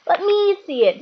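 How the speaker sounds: put-on high, pushy boy's demand. A narrator's reading. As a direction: insist like a spoilt child. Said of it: "demanded Dudley".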